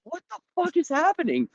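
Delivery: high, incredulous outburst; shocked disbelief. Retold or imitated. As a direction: react like you can't believe it. Reacting to shower scene.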